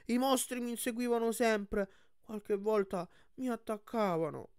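high, plaintive cyclops voice confiding fears; high-pitched, guileless cyclops; earnest young cyclops. Acted.

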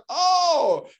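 a laughing, high, loud "oh" of discovery; delighted surprise. Retold or imitated. Preacher retelling his own discovery, surprised and laughing. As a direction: let the realisation burst out with a laugh. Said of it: "I found out something that blew my mind".

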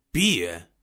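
put-on glum one-word reply in a drunkard's voice; a touch of gloom, altered voice; gloomy drunkard. A narrator's reading. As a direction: answer morosely, as a drunkard would. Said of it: "odpowiedział ponuro pijak".

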